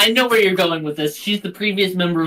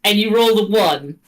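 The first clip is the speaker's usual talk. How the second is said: usual speaking voice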